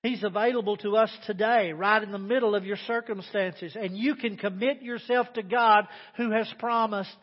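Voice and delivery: loud, fervent preaching. Acted. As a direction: preach it out to the congregation. Preaching at full force.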